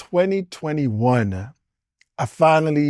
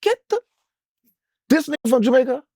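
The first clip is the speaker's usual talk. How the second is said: much higher, startled disbelief; mock surprise; 10 semitones higher, faster than usual. Him reacting to the Jamaican manager, startled disbelief. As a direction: jolt up in pitch like you can't believe it.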